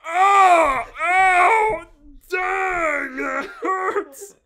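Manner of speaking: very loud, high overacted cries of pain; hammed-up pain shout. Bob overreacting to a scratched back, loud hammed-up howls. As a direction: wail like you've been shot over a scratch. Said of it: "you think he'd been shot".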